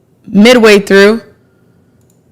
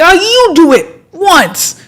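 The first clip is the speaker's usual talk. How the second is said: mocking; 8 semitones higher, slower than usual. Mocking someone's reaction.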